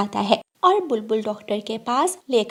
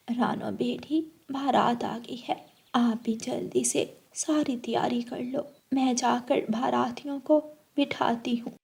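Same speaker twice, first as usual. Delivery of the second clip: soft, quieter motherly call; 8 dB quieter than usual. Acted. Mother telling daughter the wedding party has arrived, soft.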